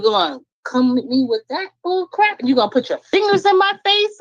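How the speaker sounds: high, outraged question; indignant. Real, not acted. Confronting the memory of her husband, high outrage. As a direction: rise high in disbelief at the insult.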